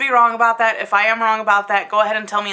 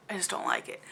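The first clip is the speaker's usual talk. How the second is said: whispered; 13 dB quieter, faster than usual. Real, not acted. Exasperated about the book.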